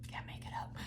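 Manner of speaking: breathy whisper under the breath; whispered aside. Real, not acted. Reader to herself over the cards, whispered. Aside about uncanny card draw.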